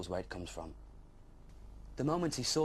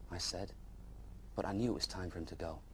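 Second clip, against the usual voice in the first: ordinary delivery, nothing marked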